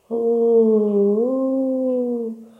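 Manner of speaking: high, drawn-out "ooh" of wind; howling wind. A narrator's reading. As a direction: imitate an eerie howling wind. (ululato del vento)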